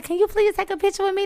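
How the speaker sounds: high sweet pleading fan; eager fan plea. Retold or imitated. Fan asking the singer for a picture, eager. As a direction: beg sweetly for a photo. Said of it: "she's like".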